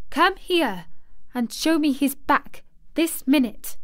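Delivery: high, imperious order; bossy child giving orders; commanding. A narrator's reading. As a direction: demand instant obedience. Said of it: "she commanded".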